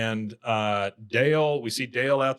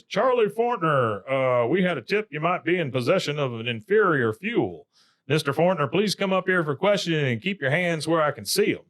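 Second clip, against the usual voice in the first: higher, official-sounding mock summons; imitated stern cop; 4 semitones higher than usual. [name] as gas cop summoning Charlie, imitated officer.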